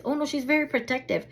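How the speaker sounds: high, quick warning; retold warning. Dog owner warning an approaching stranger, quick high warning. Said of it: "I went like this".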